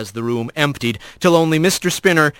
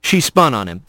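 usual speaking voice

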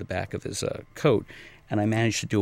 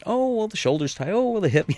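high, whiny aches-and-pains voice, pitched way up; mock complaining client; 12 semitones higher, 3 dB louder than usual. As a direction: play up a client suddenly remembering every ache. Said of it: "And you'll start doing something".

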